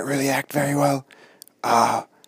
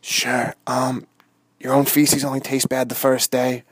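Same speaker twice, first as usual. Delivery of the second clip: normal talking voice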